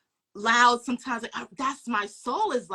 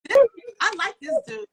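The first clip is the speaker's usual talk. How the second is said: excited retelling; 9 semitones higher, 6 dB louder than usual. Retold or imitated. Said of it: "I was like".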